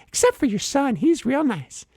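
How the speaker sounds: high squeaky voice, easing off; car bush. Acted. Bush spares driver's son and wife, milder squeaky voice. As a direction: soften the squeaky insults into grudging niceness. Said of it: "car bush is so rude to me".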